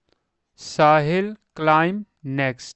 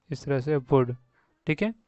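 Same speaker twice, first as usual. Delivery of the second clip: ordinary talk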